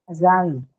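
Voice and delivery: "a sign" with a light "a", weight on "sign"; unstressed schwa on "a", stress falling on "sign"; stress on second syllable. Shown as a demonstration. Teacher showing students how the word sounds, second-syllable stress on "sign" in "a sign". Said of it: "when you say the word, it's going to sound like".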